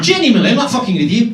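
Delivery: ordinary talk, nothing marked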